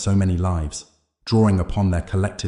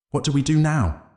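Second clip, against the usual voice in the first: higher-pitched, mildly fearful question; creeping fear; 8 semitones higher than usual. A narrator's reading. Clara asking the hero what next, mildly afraid. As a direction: ask with a touch of fear, pitched up. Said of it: "Clara asked, fear creeping into her voice".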